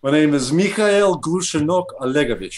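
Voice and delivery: says his full name in a loud, hammed-up Russian accent; booming mock Russian, proud and theatrical. Asked by the host to say his full name, loud Russian accent. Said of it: "With the Russian accent".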